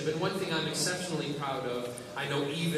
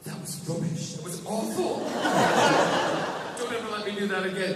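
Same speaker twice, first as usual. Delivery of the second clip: laughing at herself; 7 semitones higher, 6 dB louder than usual. Retold or imitated. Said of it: "she turned to me and she goes".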